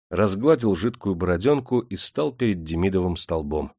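ordinary delivery, nothing marked

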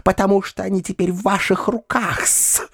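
high-pitched, squeaky scolding; squeaking. A narrator's reading. Perepelitsyna accuses uncle of killing Foma, squeaking. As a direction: squeal accusations in a pinched little voice. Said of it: "запищала опять Перепелицына".

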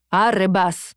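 usual speaking voice

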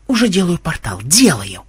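irritable, defensive retort; snapping back. A narrator's reading. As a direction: snap that you're already on it. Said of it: "огрызнулся программист". (раздражённо огрызаясь)

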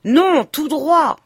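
loud, high and slow command; shouted order. A narrator's reading. Léon shouts from inside the cab at the coachman, loud and slow. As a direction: bark the order at the driver. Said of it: "cria la même voix".

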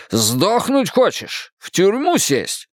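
high, drawn-out accusing questions; angry challenge. A narrator's reading. Father confronts daughter over drugs, angry challenge. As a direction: confront with slow, rising exasperation.

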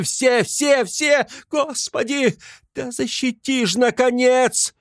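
very high, anguished lament; wailing plea. A narrator's reading. Katerina begging God and bystanders to defend Sonya, wailing. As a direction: wail and beg, climbing to a desperate cry. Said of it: "Плач бедной, чахоточной, сиротливой Катерины".

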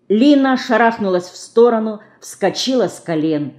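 usual speaking voice

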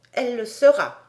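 solemn, emphatic certainty; grave reply. A narrator's reading. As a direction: pronounce it firmly, as settled fact. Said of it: "répondit gravement". (réponse grave et assurée)

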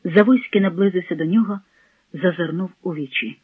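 ordinary delivery

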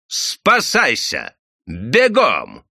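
loud, drawn-out urgent order; команда бежать. A narrator's reading. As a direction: call out a warning to run. (резкая команда)

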